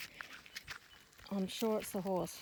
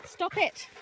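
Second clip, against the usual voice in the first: sharp scold; 9 semitones higher, 4 dB louder than usual. Real, not acted. Scolding dogs playing too rough.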